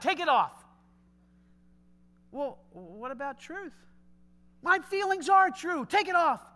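high, loud mocking demand; mock the easily offended person; offended protester. Mocking someone offended by a hat or sign, hammed-up. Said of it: "So what I feel is true".

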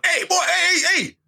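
high-pitched mock confrontation; imagined outburst, shooting up in pitch; threatening outburst. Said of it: "that's a threat".

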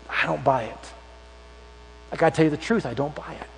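low, soft, sceptical aside; quiet doubt. Retold or imitated. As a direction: drop right down in reluctant disbelief. Acts out Thomas doubting the others.

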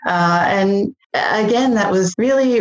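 ordinary delivery

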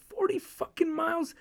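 high, slow incredulous outcry; can't believe the number, voice jumping way up; stunned disbelief. Retold or imitated.

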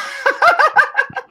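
squeaky high giggle; high-pitched laugh. Real, not acted. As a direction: burst into a shrill, high laugh. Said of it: "That's a high pitched laugh".

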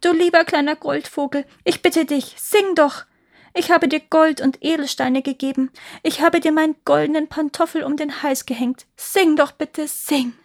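high, urgent begging; pleading. A narrator's reading. Emperor begs the golden bird to sing, pleading. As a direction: beg loudly, voice lifted. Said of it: "flehte der Kaiser".

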